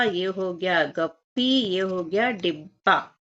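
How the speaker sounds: slow, drawn-out words, each one rising at the end; slow reading aloud. Shown as a demonstration. Teacher to children, sounding out joined letters slowly. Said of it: "इन दोनों वर्णों को मिला के लिखना है और पढ़ना है".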